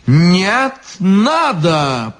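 high, insistent contradiction. A narrator's reading. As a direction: lift the voice and insist. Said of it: "повысил голос изящный чёрт".

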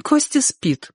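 high-pitched female character; woman's voice. A narrator's reading. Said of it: "ответил ему женский голос". (высокий женский голос)

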